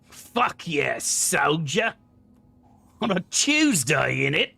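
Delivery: lightly mocking British accent like Karlach. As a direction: tease Karlach's British accent with a cheeky put-on. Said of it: "I could not get over Karlach's accent".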